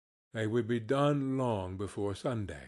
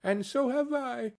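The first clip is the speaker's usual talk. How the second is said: put-on much higher little child's voice; another child chiming in; 11 semitones higher than usual. A narrator's reading. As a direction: pipe up high as another child. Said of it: "explained another".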